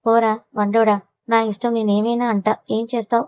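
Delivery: usual speaking voice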